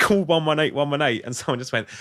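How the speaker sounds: normal talking voice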